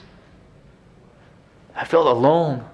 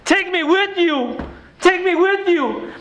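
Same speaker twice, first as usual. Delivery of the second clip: much higher and slower, pained and pleading; anguished repeat; 12 semitones higher, slower than usual. Real, not acted. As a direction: cry out the words that haunt you. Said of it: "I can't get them out of my mind".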